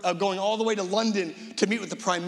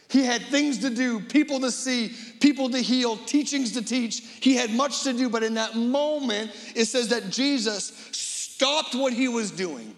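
raised, emphatic preaching build; 5 semitones higher than usual. Acted. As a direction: lift it like a pulpit climax. Preacher builds list of duties.

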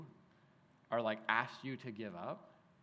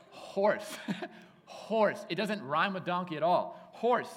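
playful emphasis; 5 semitones higher, 6 dB louder, slower than usual. Real, not acted.

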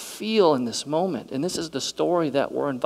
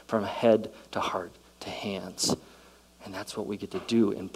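whisper; 2 semitones lower, 5 dB quieter than usual. Real, not acted. Sermon climax, hushed.

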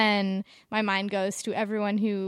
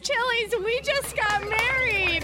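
calling out; 13 semitones higher than usual. Acted. Announcing to the whole Chili's.

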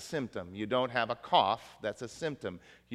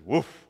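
short, punchy spoken woof; imitated dog woof; 5 dB louder than usual. Preacher voices the joke's Labrador, short woof. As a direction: give one quick dog's woof.

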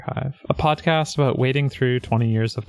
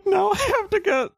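laughing; 11 semitones higher than usual. Real, not acted. Laughing, forced to restart intro.

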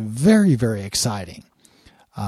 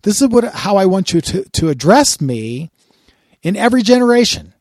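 booming voice of God; 6 semitones higher, 5 dB louder than usual. Acted. God's words, preached at full force.

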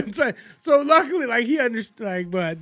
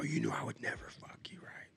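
whispered plea to the boss; retold whisper; 4 semitones lower, 14 dB quieter than usual. To the boss on FaceTime, whispered plea. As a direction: breathe it low, like a secret. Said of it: "I go".

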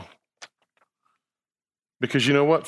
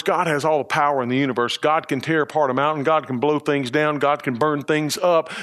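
ordinary talk, nothing marked

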